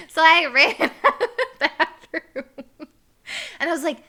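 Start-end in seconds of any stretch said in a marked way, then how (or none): 0.1-2.4 high, loud, breaking into laughter; real laughing. Real, not acted. Storyteller recalling her escape, laughing as she talks. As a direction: laugh right through the words.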